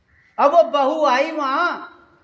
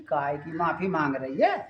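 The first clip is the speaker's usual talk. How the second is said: put-on much lower and softer old woman; old mother-in-law; 9 semitones lower, 9 dB quieter than usual. Mother-in-law puzzled by the apology, low and soft. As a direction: drop low and quiet like a granny.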